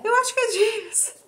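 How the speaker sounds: high excited near-shout of her hunch; wanting to shout. Retold or imitated. Mother recalling the ultrasound she longed to shout about, excited high outburst. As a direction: blurt out the secret hope, high and loud. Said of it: "que eu queria gritar".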